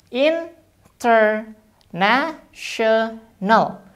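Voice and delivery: international very slowly, NA stressed. Shown as a demonstration. Teacher counting syllables slowly for students. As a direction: drag international out and hit NA. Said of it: "tekankan di ne-nya".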